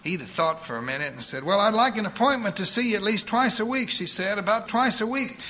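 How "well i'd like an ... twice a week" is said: put-on higher, fast voice of a bossy old churchwoman; eager church lady. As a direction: rattle it off like a pushy saint. Edith bargains for visits.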